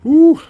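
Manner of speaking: loud, high-pitched whew; nervous whew. Real, not acted. Waiting anxiously on the weigh-in news, nervous whew. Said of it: "I'm nervous".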